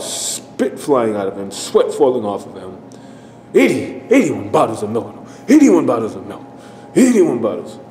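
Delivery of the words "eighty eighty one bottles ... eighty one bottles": crazed, insistent repeated chant; demented fit. Retold or imitated. Clown running crazily from child to child, demented chanting. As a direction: hammer out the line like a frantic clown. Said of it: "in his demented fit, running crazily from child to child".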